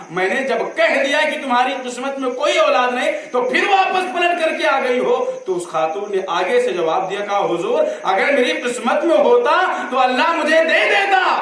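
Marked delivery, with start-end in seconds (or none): 0.1-5.3 higher, sharp scolding of the woman; stern rebuke. Acted. Saint rebukes returning woman. 7.4-11.4 high, loud, bold answer; impassioned plea. Acted. Woman's bold reply to saint.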